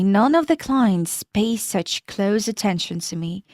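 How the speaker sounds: ordinary delivery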